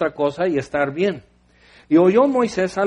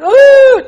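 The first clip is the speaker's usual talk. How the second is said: loud high mock wail of the crowd; 14 dB louder than usual. Preacher mimics Israelites weeping loudly, hammed-up wailing. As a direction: wail out like a whole crying camp.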